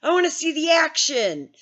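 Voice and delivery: put-on loud, high gleeful kid, slow on the question; thrilled teen who doesn't get the danger; clueless excited teenager; naive excitement. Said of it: "and I'm like".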